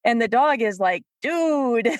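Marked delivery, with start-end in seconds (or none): 1.2-1.9 raised, deadpan dog voice; lightly voiced bored dog; unimpressed dog. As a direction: let the dog brush off the pushy owner.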